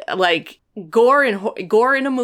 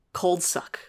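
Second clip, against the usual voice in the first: whisper; 8 dB quieter than usual. Real, not acted. Aside whispered.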